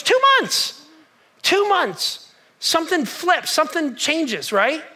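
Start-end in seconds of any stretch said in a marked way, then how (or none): 0.0-2.3 Two months said much higher, then echoed; incredulous emphasis. Real, not acted. Preacher to congregation, stunned at two months, emphatic. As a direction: hammer home how short it was. Preacher stresses short time.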